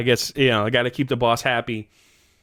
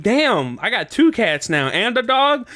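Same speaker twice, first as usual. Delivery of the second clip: high, incredulous exclamation; amazed; 10 semitones higher than usual. Retold or imitated. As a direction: marvel at the sudden pile of pets. Acts out his amazement at animals.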